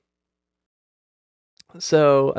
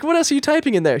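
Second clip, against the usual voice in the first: high-pitched accusing question; mock indignation; 11 semitones higher than usual. Customer questioning agent about her notes, mock indignant. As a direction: demand an answer in mock outrage.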